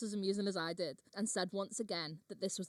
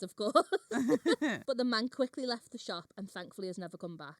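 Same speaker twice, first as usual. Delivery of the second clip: ordinary talk, nothing marked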